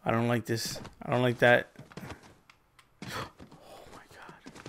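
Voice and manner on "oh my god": whisper. Real, not acted. Gasp then shocked reaction.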